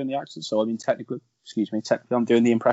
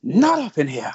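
"Not up in here" said a bit louder and punchier; movie line impression; 3 semitones higher, 5 dB louder, slower than usual. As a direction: repeat "Not up in here" with a light sassy movie flair. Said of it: "my favourite of the whole part is".

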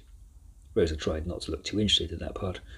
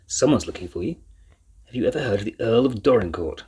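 hearty; 3 semitones higher, 4 dB louder than usual. A narrator's reading. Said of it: "with a sudden heartiness".

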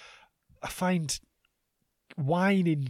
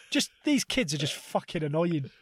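usual speaking voice

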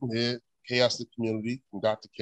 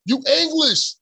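high, mocking taunt, much higher than his own voice; 4 dB louder than usual. Teasing Sam as a heckler, high mock voice. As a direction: mimic a heckler telling Sam where he's from. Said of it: "I'd be like".

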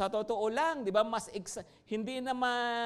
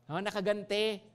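ordinary delivery

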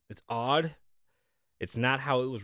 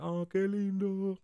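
slow, higher cooing; cooing Spanish; 6 semitones higher, 4 dB quieter, slower than usual. Retold or imitated. Owner cooing in Spanish over her happy fish. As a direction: coo softly over the fish. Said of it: "And I was like".

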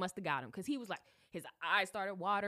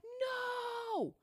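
high shouted no; a high cry of horror; horrified shout; 14 semitones higher than usual. Retold or imitated. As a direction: yell it in disbelief. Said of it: "And I'm like".

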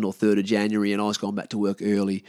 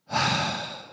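long, breathy hungover sigh; acted groggy exhale; weary sigh; 3 dB quieter than usual. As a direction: let out a reluctant morning sigh. Hungover reaction to eager caller.

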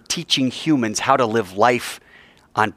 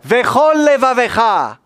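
with gusto; 10 semitones higher, 4 dB louder than usual. Shown as a demonstration. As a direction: lead the congregation in a full-voiced prayer call. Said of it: "say it with some gusto".

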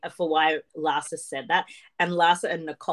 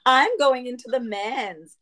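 higher, slow announcement; hammed-up declaration in a raised pitch; mock proclamation; 6 semitones higher, 4 dB louder, slower than usual. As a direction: proclaim it grandly, stretching the words. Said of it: "proclaims that she's doing it".

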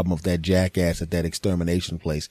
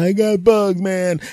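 higher, louder whiny neighbor voice; neighbor complaining; 11 semitones higher, 5 dB louder, slower than usual. Retold or imitated. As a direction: whine about the bugs like the neighbor.

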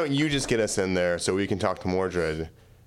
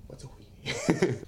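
whispering; 8 semitones higher, 3 dB quieter than usual. Acted. Confused question in a whisper.

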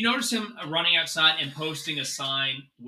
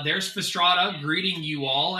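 normal talking voice

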